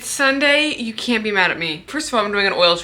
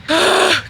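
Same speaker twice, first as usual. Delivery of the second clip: startled gasp; 8 semitones higher than usual. Real, not acted. Sudden gasp mid-walk.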